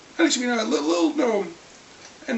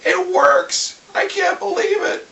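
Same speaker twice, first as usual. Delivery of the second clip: excited; 7 semitones higher, 4 dB louder, slower than usual. Retold or imitated. Spell succeeds, excited.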